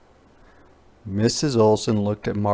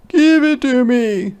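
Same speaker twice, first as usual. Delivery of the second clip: high, loud, slow demand; a boy insisting on his camera; loud high boy's demand; 13 semitones higher, 7 dB louder than usual. Acted. Martin quarrel.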